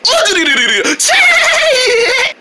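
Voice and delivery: goofy high laugh. Acted. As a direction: burst into a silly laugh. Character laughs at own joke.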